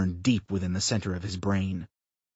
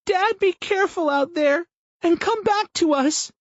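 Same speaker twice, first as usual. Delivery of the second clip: put-on high pleading little girl; a tearful daughter begging; child's voice; 5 dB louder than usual. A narrator's reading. Daughter pleads with father to return, high child voice.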